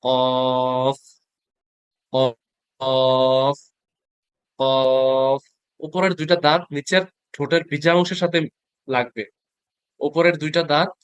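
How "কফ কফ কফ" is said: long held কফ with teeth on the lip; drawn-out Arabic fa. Shown as a demonstration. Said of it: "দুই নোক্তা দিলে কি হবে?". (দাঁত ঠোঁটে লাগিয়ে টেনে কফ)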